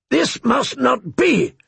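slow booming outrage; thundering. A narrator's reading. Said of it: "Kotho thundered".

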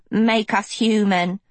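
lightly voiced creature demand; demanding animals. A narrator's reading. Animals charge towards their savior, mildly voiced demand. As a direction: press the demand as a pleading beast. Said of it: "they demanded".

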